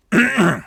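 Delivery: a pointed throat clear; short, deliberate throat clearing. Acted. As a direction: clear the throat to get attention. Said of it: "Coach Templin cleared his throat".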